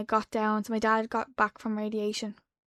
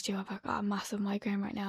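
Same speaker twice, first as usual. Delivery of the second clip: whispering; 8 dB quieter than usual. Retold or imitated. Texting cousin, whispered retelling.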